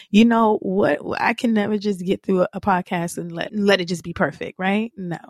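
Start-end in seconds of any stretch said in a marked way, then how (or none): none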